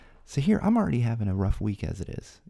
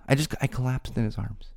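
ordinary delivery, nothing marked

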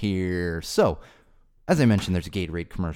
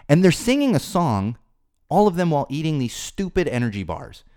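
heated rant; 7 semitones higher, 3 dB louder than usual. Real, not acted. Rising rant about Gatorade ad.

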